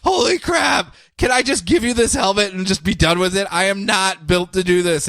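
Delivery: high, whining complaint; whiny Khalid. Retold or imitated.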